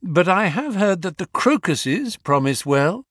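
breezily unruffled; imperturbable. A narrator's reading. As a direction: carry on as if nothing happened. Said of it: "continued my companion imperturbably".

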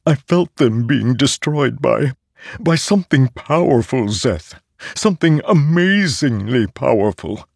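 a living sword in awe; awed talking sword. Acted. Nightblood voice.

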